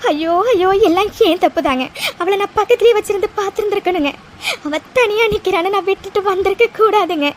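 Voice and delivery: tearful self-blame, breaking into sobs; crying. Acted. Wife blames herself to husband for the lost girl, crying. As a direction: lament it, ending in a sob. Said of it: "அழாத லக்ஷ்மி".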